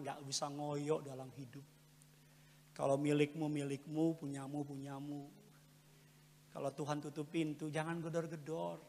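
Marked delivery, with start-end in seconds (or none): none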